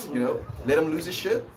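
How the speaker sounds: ordinary delivery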